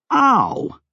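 high, sharp yelp; cry of pain. A narrator's reading. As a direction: yelp from a slap. Cry after a hard slap.

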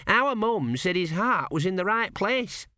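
put-on high, slow, earnest young lad; a naive lad offering comfort; earnest apprentice. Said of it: "said Gurn".

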